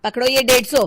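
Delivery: loud, curt send-off; angry dismissal. Acted. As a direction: send the seller packing with force. Dismissing the seller angrily. (गुस्से में ज़ोर से दफ़ा करना)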